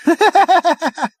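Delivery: high, loud giggling burst; a helpless giggle. A narrator's reading. Prack laughs at the truth, high giggle.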